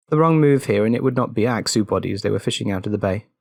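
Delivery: ordinary delivery, nothing marked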